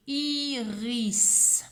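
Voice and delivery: "iris" with long i, r and s; drawn-out syllables. Shown as a demonstration. Teacher finishes the word, "iris" drawn out. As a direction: stretch "iris" through to a held s. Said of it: "il a deux syllabes".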